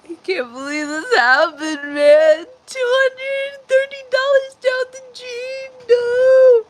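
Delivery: high, loud, half-joking wail; loud mock-crying lament. Real, not acted. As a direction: wail about the lost money. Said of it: "I'm gonna start crying for real".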